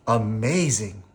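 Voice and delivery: high, thrilled counting of each pom-pom; delighted counting. Acted. Missus Goldman counting Sophia's pom-poms in high delight. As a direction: marvel at them one by one.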